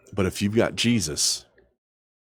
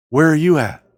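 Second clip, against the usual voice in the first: louder, higher "Where are you at?" hitting you; loud challenge; 7 semitones higher, 6 dB louder than usual. Real, not acted. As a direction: call it out to the congregation. Preacher calls out question.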